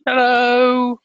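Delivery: very high, loud angel hello; a sweet heavenly falsetto hello. Acted. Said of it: "That's my angel voice".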